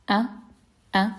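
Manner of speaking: "A" with a throat catch; glottal stop. Shown as a demonstration. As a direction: stop the air before "A". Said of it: "articulated in the deepest part of the throat".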